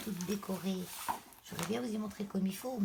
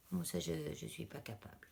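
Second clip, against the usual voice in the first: normal talking voice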